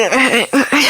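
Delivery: short rising laugh; bright laugh. Real, not acted. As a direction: burst out laughing. Laughing at own story.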